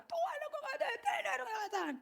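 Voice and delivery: high, soft, whiny mock prayer with a laugh; mock a whining pray-er, high and thin. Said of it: "Teriak-teriak".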